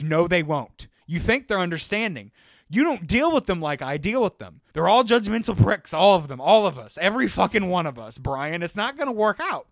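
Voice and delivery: loud retold rant, building in force; heated arguing. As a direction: hammer the point home to a naive friend. Retold argument with Brian.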